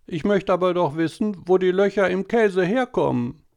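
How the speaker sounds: put-on high, whining little boy voice; whiny boy. A narrator's reading. Tobi whining to mama at dinner, high and whiny. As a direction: whine like a small boy pestering mama. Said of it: "Eine weinerliche Jungenstimme".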